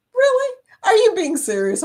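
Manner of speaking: sudden high Really, half laughing; can't believe it's real; real surprise, then a mock challenge; surprised disbelief. Real, not acted. Disbelief at Arizona accent claim.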